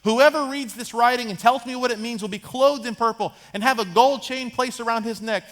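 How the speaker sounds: lightly acted king making a proclamation; proclaiming king. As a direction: announce the reward to the whole court. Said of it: "He brings them all in and says".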